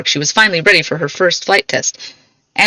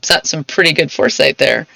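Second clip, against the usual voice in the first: ordinary delivery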